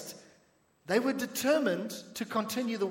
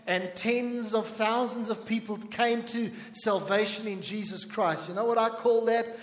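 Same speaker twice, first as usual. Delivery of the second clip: normal talking voice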